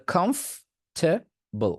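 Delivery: Comfortable very slowly as "khomf... te... bol"; slow, in three parts. Shown as a demonstration. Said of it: "এটা হচ্ছে আপনার আসল উচ্চারণ". (Comfortable খুব ধীরে ভেঙে)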